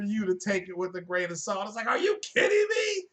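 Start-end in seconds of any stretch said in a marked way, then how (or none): 1.9-3.0 exaggerated outraged disbelief, pitched way up; mock disbelief. Streamer mocking viewers who ignore the disclaimer, high mock outrage. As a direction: squeal it like a scandalised fan.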